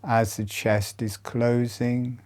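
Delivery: soothing body-awareness cues; guided meditation. Acted. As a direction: talk them through each breath, unhurried. Leads a chest breathing meditation.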